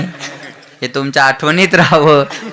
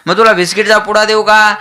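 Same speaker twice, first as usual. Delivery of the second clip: higher-pitched mock mother's offer; coaxing mother; 7 semitones higher than usual. Mother offering biscuits to crying child, coaxing lift. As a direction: coax the little one, voice lifted.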